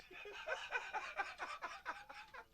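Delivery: laughing hard at the punchline; high breathless laugh. Real, not acted. Laughing at his own story.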